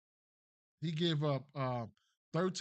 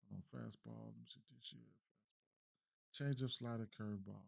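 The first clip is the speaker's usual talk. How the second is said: muttering to himself; 5 semitones lower, 13 dB quieter than usual. Real, not acted. Reading stats off screen.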